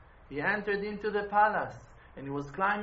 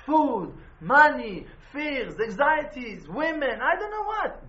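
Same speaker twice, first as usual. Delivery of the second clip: preaching; 3 semitones higher, 8 dB louder, slower than usual. Acted. Listing what drives you crazy.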